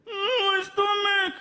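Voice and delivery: high, slow crying whine; sick child whimpering about his stomach; whining stomach ache. Retold or imitated.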